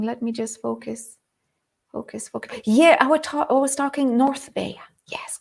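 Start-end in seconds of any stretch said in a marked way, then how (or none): 0.0-2.6 quiet self-talk. Real, not acted. Muttering to herself to refocus.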